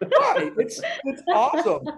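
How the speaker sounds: high laughing disbelief. Real, not acted. Laughs at regretted loud image.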